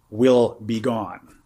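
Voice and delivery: very slow "will be gone"; slow emphasis. Shown as a demonstration. Teacher driving home the loss of self, slowly. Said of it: "let me underline the word".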